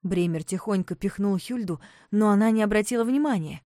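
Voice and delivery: plain reading voice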